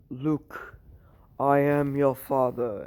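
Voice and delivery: slow, drawn-out Star Wars father line; mock-epic slow movie reveal; Star Wars movie quote. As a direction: impersonate the famous Star Wars reveal, drawing out each word. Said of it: "You stole that shit from Star Wars".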